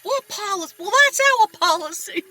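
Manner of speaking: high, sarcastic mimic of husband's outrage; mocking husband. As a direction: throw the words back at her, mocking. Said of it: "and he's like".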